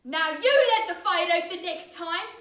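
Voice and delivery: loud, angry father's warning; vigorous threat. Acted. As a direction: drive each word home while beating. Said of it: "the man said vigorously".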